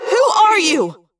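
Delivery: high, loud echoing yell; yelled in thought-speak. A narrator's reading. Confused Rachel demanding the girl's identity, echoing yell. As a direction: demand it at a shout. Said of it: "I yelled in thought speak".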